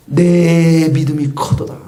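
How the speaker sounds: slow, weighty proclamation; solemn Jesus voice. Shown as a demonstration. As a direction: pronounce it like a solemn blessing. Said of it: "이런 소리예요". (예수님처럼 장엄하고 느리게)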